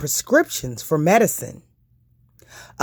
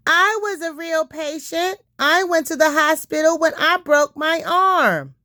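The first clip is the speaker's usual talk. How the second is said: put-on higher, proud little girl; child's voice; 10 semitones higher than usual. Lainey telling the doctor about her broken arm, higher child voice.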